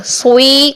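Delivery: سوئی as swee with a w glide; wrong added w. Shown as a demonstration. Teacher repeating the mistake for students, سوئی with w added. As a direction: lips rounded between s and ee in سوئی. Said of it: "کریں گے تو واو add ہو رہا ہے".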